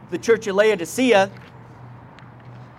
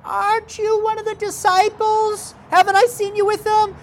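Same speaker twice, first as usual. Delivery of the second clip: put-on much higher, girlish accusing questions; little girl voice. Servant girl confronting Peter by the fire, high girlish voice. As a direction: pipe up like a small prying maiden.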